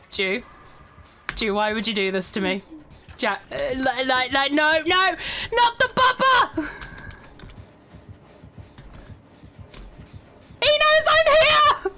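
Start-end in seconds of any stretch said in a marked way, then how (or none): 4.5-11.9 much higher, loud and slow; panicked yelp. Real, not acted. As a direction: scream as the killer closes in. Killer finds him, panic.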